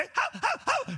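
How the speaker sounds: very high mock yaps; playful high-pitched little-dog yaps; tiny dog yapping. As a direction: squeak it like a lapdog. Imitates small fluffy dog.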